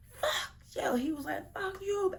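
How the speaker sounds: very high, strained yelled curse; screaming in mock anger. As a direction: scream it like the truck fight. Reenacting truck argument scene.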